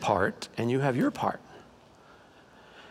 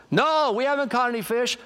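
calling back; 8 semitones higher than usual. Retold or imitated. Fishermen answer the man on shore.

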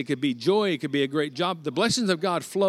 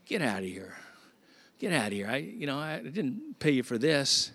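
disgusted dismissal; 5 dB quieter than usual. Acted. Fed-up king dismisses Balaam.

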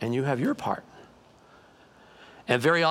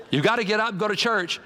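higher-pitched nagging wife imitation; insistent wife; 5 semitones higher than usual. Wife orders husband out of bed, insistent. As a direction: press it as a firm order. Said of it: "his wife came and said".

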